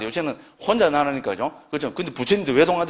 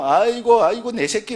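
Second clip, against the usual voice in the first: higher, louder fond cry of a doting parent; 7 semitones higher, 4 dB louder than usual. Retold or imitated. Monk mimics a parent fussing over an only child, doting.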